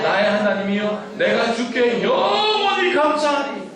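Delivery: loud, rising scripture reading, ending slow; psalm recitation. Acted. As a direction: read the psalm aloud with the church. Congregation reads psalm aloud.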